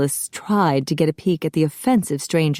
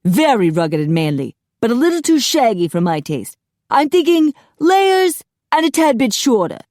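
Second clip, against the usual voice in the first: animated appraisal, climbing high; fussy stylist; 6 semitones higher, 5 dB louder than usual. A narrator's reading. Apollo plans Phil's new cut, fussy and animated. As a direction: muse aloud like a flamboyant hairdresser.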